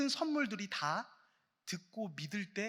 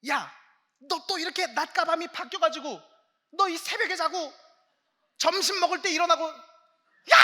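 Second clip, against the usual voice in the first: much higher and louder scolding, ending in a shouted yell; mother scolding her child, then yelling; nagging parent; 8 semitones higher, 11 dB louder than usual. Retold or imitated. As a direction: scold a kid who sleeps till noon, building to a bellow. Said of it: "막 부모님 막 그러실 때 있잖아요".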